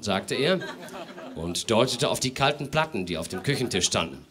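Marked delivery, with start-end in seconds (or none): none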